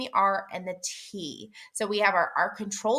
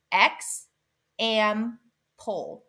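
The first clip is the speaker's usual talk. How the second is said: X, am, pull, each alone with a pause after it; syllable by syllable; much slower than usual. Shown as a demonstration. Teacher reading those syllables of example, syllable by syllable. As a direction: read X, am, pull as separate syllables, dropping on pull. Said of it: "I'm going to read those syllables".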